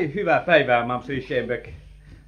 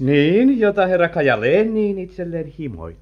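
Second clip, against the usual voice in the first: pointed jab; 6 semitones higher, 8 dB louder than usual. Acted.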